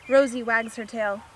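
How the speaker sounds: plain reading voice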